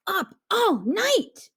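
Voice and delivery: high, slow cheering. A narrator's reading. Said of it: "cheered Jim and his siblings".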